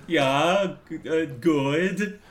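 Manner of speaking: high, halting, drawn-out fake voice; put-on radio voice. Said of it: "it's all like that".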